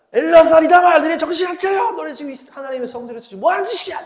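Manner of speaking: high, shouted dressing-down; angry scolding. Acted. Moses berating the sons in God's sanctuary, angry scolding. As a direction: shout the names, then pile on the rebuke. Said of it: "막 하면서 막 뭐라 하는 거지".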